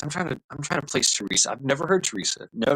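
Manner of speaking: usual speaking voice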